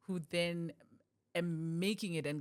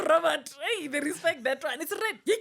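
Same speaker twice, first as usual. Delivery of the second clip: high, loud mock cheer; hammed-up crowd enthusiasm; excited crowd; 8 semitones higher, 8 dB louder than usual. Comedian acting out a movie crowd, excited.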